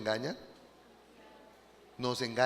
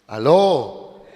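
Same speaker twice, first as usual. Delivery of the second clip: calling out; 12 dB louder than usual. Real, not acted. Calls to congregation.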